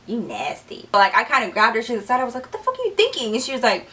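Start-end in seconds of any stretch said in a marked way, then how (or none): 0.1-0.9 soft, low-pitched judging aside; low disgust. Retold or imitated. She just looks at Heather, low disgust. Muttered judgment at her friend. 2.4-3.3 hushed scolding. Retold or imitated. Grabbed her friend aside, hushed scolding.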